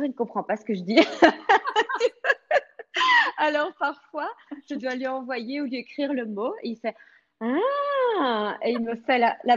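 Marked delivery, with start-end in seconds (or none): 1.0-3.3 long high real laugh. Real, not acted. Rit de l'élève perdu. 7.4-8.6 sudden realization. Retold or imitated. L'élève comprend enfin.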